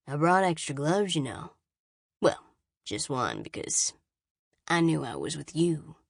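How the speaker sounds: a hint of a sleazy guard, voice dropping at the ends; lightly voiced Brady. A narrator's reading. Guard needling the scavenger he escorts, lightly voiced taunting.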